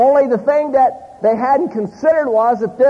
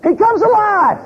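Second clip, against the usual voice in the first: much higher-pitched punchline; mock startled exclamation; 11 semitones higher, 3 dB louder than usual. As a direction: burst out with the comic reveal. Punchline of stabbed actor reviving.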